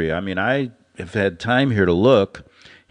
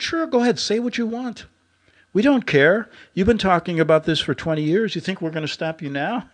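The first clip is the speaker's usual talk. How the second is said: lightly voiced captor, much higher and taunting; mock captor voice; 9 semitones higher than usual. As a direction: toss it off like a smug guard, pitched up high. Captor's mocking reply, quoted.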